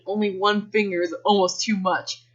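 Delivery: usual speaking voice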